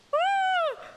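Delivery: high loud whoop; real gleeful whoop; excited whoop. Real, not acted. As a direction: cheer out loud. Cheering again.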